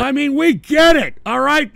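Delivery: loud, high-pitched exasperated outburst. Real, not acted. Host cutting off a viewer's explanation, loud and exasperated. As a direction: cut in, loudly fed up.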